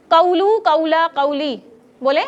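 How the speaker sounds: قولو، قولا، قولی with the final vowel stretched and clear; ending vowels shown. Shown as a demonstration. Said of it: "پہلے او کی sound آئے گی". (قولو، قولا، قولی کے آخری حروف واضح)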